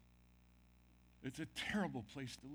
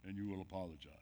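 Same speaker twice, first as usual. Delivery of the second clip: mock stern Lord; 6 semitones lower, 3 dB louder than usual. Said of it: "Jesus has not stood there and said".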